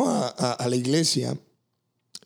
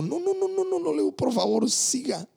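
much higher, pleading no no no; 12 semitones higher than usual. Retold or imitated. Pastor tearfully begging the older woman not to stop, much higher. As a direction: beg her to keep going, voice jumping up high.

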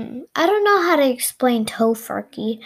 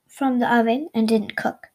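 usual speaking voice

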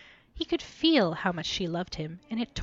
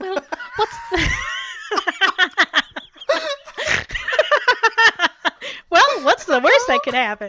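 higher, louder, cracking up; laughing flippancy; 13 semitones higher, 12 dB louder than usual. Acted. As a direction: breaks into laughter mid-line. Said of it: "It's a coma".

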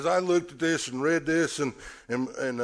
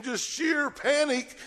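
panicked shout; 12 semitones higher, slower than usual. Acted. Describing disciples' panic, shouting.

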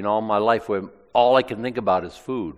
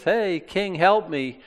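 higher mock call for help; calling out to the king; 8 semitones higher, slower than usual. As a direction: imitate a woman crying out to the passing king. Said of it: "the lady shouts to him and says".

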